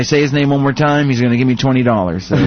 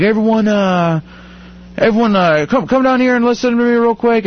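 raised, high-pitched announcement; calling out to a crowd; 7 semitones higher than usual. Retold or imitated. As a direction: mimic someone trying to get everyone's attention. Acts out a speaker gathering the crowd.

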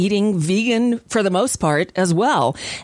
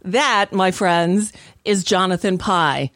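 ordinary talk

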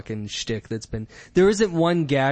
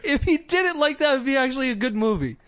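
high, giggling through the words; real laughter breaking in; laughing; 11 semitones higher, faster than usual. Real, not acted. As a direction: laugh as you say it. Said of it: "laughing while talking".

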